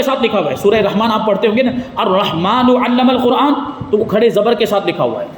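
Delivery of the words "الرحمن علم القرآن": slow, measured recitation of the verse; Quranic recitation. Shown as a demonstration. Teacher reciting the verse to students, slow and measured. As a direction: intone the verse slowly as in tilawat.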